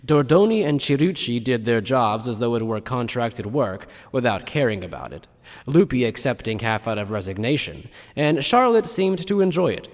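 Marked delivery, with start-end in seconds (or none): none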